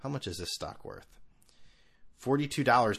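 usual speaking voice

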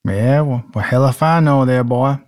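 put-on Southern accent. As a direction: jokingly show off a Southern accent. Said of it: "for an accent".

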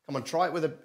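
ordinary talk

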